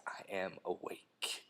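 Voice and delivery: slow, soft imitation of a TV drug lord. Podcaster imitating a character waking up, slow hushed impression. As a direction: drag it out quietly, playing the character.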